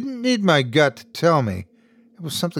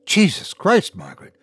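high, sharp snap at her; snapping; 6 semitones higher than usual. A narrator's reading. Husband snapping at wife over the murder, angry. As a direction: bite the words off in anger. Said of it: "I snapped".

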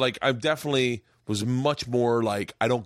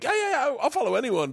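much higher, eager agreeable self-mockery; eager yes-man; 13 semitones higher than usual. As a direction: play your over-eager people-pleaser self. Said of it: "normally I'm always like".

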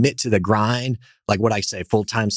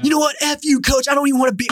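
much higher, squeaky bratty outburst; mock tantrum; faster than usual. Will Ferrell telling the coach off in a high bratty squeal. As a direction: shriek it like a spoiled kid quitting.

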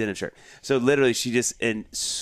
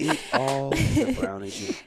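mock sweet teasing; 12 semitones higher, slower than usual. Repeated teasing line.

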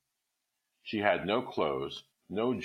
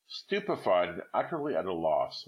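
ordinary delivery

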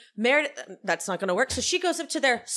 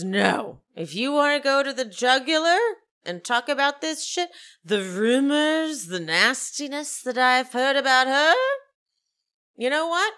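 raised, indignant mock diva threat; Meredith; 4 semitones higher, 4 dB louder than usual. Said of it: "and she says".

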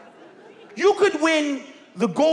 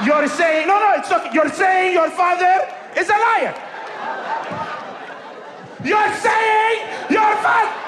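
hammed-up indignant father shouting; mock angry yell; 6 semitones higher than usual. Dad shouting at his son, a hammed-up yell.

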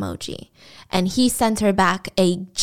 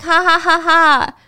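high, louder ha ha ha laughter; mock laugh; 7 semitones higher, 6 dB louder than usual. Wanda laughing at Mike's panic, a pitched-up mock laugh. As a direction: read Wanda's text laugh out loud, pitched up.

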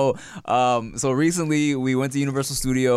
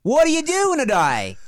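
high, hammed-up Australian accent; 12 semitones higher, 4 dB louder than usual. Shown as a demonstration. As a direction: belt it out as a caricature Aussie. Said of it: "A Boston British accent is Australian".

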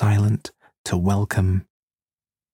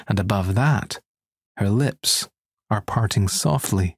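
ordinary delivery

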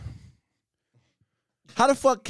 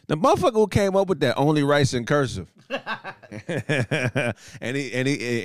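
ordinary talk, nothing marked

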